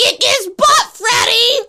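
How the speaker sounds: high, loud ringside cheer; cheering yell. Retold or imitated. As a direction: yell it from the stands for your man. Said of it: "Sam yells".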